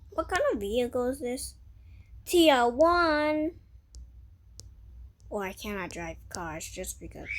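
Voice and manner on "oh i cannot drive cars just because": whispered aside to self; whispering. Real, not acted. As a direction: breathe it out under your breath.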